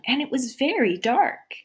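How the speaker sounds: plain reading voice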